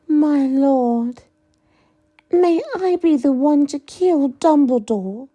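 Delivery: high, eager sing-song voice. Acted. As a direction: lilt it like a giddy fanatic. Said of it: "Her sing-song voice".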